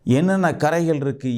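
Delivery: usual speaking voice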